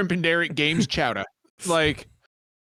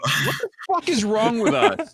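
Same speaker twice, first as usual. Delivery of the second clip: laughing disbelief; 8 semitones higher, slower than usual. Real, not acted. Group cracking up.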